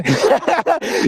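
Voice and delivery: scoffing burst of laughter; short mocking laugh. Real, not acted. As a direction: laugh at him outright.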